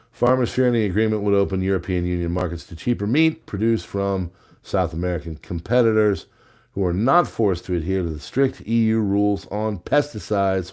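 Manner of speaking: ordinary talk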